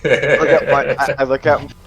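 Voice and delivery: usual speaking voice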